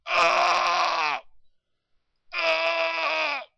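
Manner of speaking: long drawn-out loud "ahh" call; imitated creature holler. DJ imitating Bigfoot's call for Chet, long loud holler. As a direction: howl out a sustained wordless cry, twice. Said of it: "he's saying".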